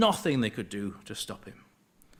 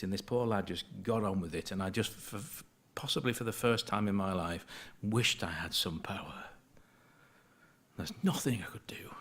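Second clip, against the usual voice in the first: speaking quietly; 2 semitones lower, 5 dB quieter than usual. Real, not acted. Preacher recalling a suffering lad he could not help, speaking quietly. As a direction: let the voice sink towards a near whisper. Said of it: "am I speaking too quietly".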